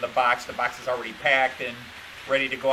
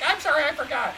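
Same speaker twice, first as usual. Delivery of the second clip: much higher, sheepish imitation of his wife; mock wife apology; 12 semitones higher than usual. Husband mimicking wife's forgetful apology, high voice. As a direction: squeak out a fake apology as Joyce. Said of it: "She's like".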